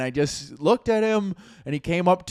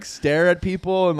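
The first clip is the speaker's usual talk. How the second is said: ordinary talk, nothing marked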